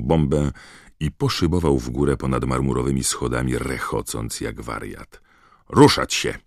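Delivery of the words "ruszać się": loud, sharp command; sharp order. A narrator's reading. Professor moves the soaked crowd on, sharply. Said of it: "powiedziała ostro".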